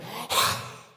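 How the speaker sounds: big loud gasp for air; acted-out gulp of air; surfacing gasp. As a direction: gasp as if bursting up from underwater. Surfaces from under water.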